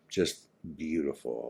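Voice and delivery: usual speaking voice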